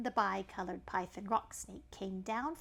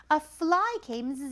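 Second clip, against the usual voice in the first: slow louder zzz buzz; fly buzzing; 6 semitones higher, 9 dB louder than usual. Acted. Narrator imitating the fly arriving.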